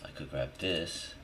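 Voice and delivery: ordinary talk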